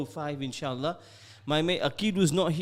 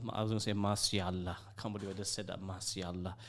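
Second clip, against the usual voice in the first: softer, quick muttered aside to himself; embarrassed aside; 8 semitones lower, 8 dB quieter than usual. Real, not acted. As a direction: laugh off the slip under your breath. Embarrassed aside after a slip.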